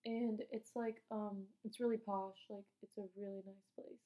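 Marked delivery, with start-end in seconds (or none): 2.5-4.0 whispered aside, no voice. Real, not acted. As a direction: breathe it out as a hushed secret. Unvoiced aside about posh place.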